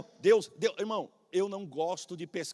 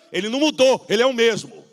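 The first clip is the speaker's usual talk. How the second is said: loud, higher preaching of "ele não mudou, ele é o mesmo"; louder preached repeat; 5 semitones higher, 11 dB louder than usual. Real, not acted. Pastor insisting to the church, loud repeated declaration.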